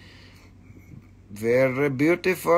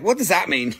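breaking into giggles while speaking; laughing; faster than usual. Real, not acted. Seller amused by a mangled word, real laughing. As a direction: let the question tip into laughter.

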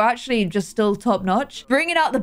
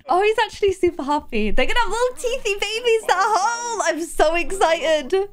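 much higher, bubbly excitement; excited squeal; 10 semitones higher than usual. Real, not acted. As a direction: gush over the babies. Sim's happy reaction to pregnancy.

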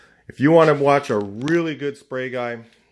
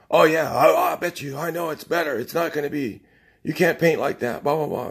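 higher, put-on voice of a smug painter; mocking the know-it-all; 6 semitones higher than usual. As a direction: parody his know-it-all bragging. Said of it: "And he goes".

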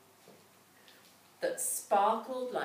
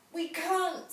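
high, loud horrified splutter; 10 semitones higher, 7 dB louder than usual. A narrator's reading. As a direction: blurt it out, appalled. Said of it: "spluttered the woodcutter, horrified".

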